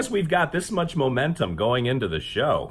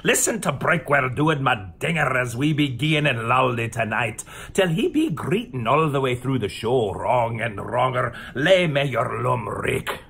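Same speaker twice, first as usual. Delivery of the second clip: put-on Scottish accent; 2 semitones lower than usual. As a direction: ham up a Scots brogue full of dialect. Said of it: "to be able to talk like a Scottish person".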